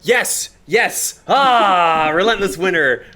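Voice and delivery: loud, high victory whoop; triumphant cheer. Real, not acted. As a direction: celebrate like you just won. Celebrating a kill.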